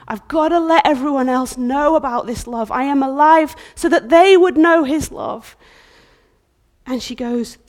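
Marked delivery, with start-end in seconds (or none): 0.1-5.6 louder and higher, rising joy; loud joyful proclamation; exuberant cry. Acted. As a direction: burst out with joy. Bride voiced building excitement.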